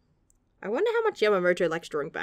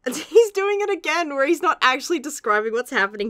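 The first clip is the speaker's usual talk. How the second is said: ordinary talk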